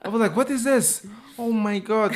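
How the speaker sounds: put-on shocked by a strange accent; baffled disbelief. Newcomer hears Scouse for the first time, stunned cry. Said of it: "so I was like".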